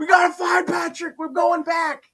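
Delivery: high-pitched mock-heroic urgency; rally the team to turn back; urgent rallying cry. Retelling the search for a lost teammate, urgent rallying.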